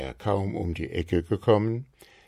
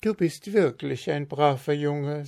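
pleased wife; 8 semitones higher than usual. A narrator's reading. Frau Marker praises.

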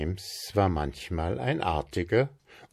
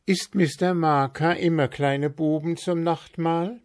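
put-on curious boy; 10 semitones higher than usual. A narrator's reading. Tommy asks Frau Marker.

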